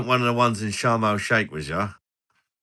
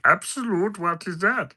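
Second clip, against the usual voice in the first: much higher playful voice; silly high voice; 11 semitones higher than usual. Pretend. Streamer asking about vodka in a put-on high voice. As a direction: pitch it up like a cartoon.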